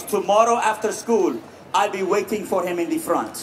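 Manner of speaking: a hint of a stern Iranian father, calm and low; lightly done Persian-accented dad promising to handle it; dad's Iranian accent. Military dad telling his bullied son he will wait, mild accent. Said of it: "He goes".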